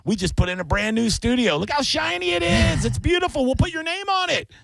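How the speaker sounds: high, bright hard-sell voice; salesman pitch. Retold or imitated. Rival market pitching the star a shiny new studio, high salesman voice.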